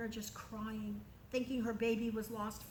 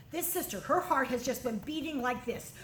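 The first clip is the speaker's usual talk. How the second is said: ordinary delivery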